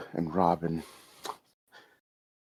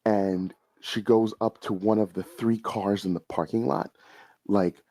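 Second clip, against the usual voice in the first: ordinary delivery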